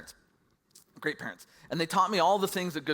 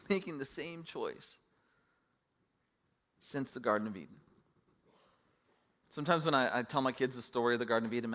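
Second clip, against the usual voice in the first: normal talking voice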